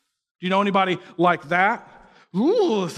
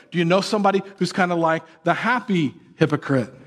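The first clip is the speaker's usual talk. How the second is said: ordinary talk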